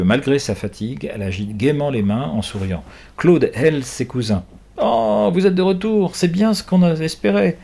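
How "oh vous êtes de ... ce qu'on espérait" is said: joyful greeting. Acted. Claude greets returning cousins.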